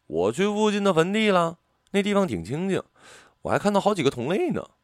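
high-pitched chirpy enthusiasm; high, lively and pleased; eager, in high spirits. A narrator's reading. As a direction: report it with bubbly excitement. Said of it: "我兴致勃勃地说道".

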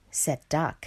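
ordinary delivery, nothing marked

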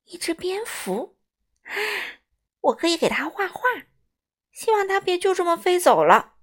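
hopeful, lifted storybook voice; excited thought. A narrator's reading. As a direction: think aloud with growing excitement. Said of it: "米歇尔想".

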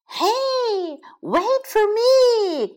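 high, loud, drawn-out hen's cry; pleading high-pitched hen call; clucking hen. A narrator's reading. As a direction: squawk after the others as they leave. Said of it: "clucked Hilary".

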